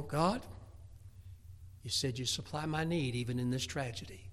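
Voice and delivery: hushed whispered devotion; quiet trusting prayer. Acted. A believer praying in tragedy, hushed trusting whisper. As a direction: whisper it as a tender vow.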